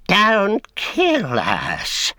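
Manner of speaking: high, slow, weeping creature wail; wailing Gollum plea. A narrator's reading. Gollum begging Sam for his life, high weeping wail. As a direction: sob it out as a cringing wretch. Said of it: "he wept".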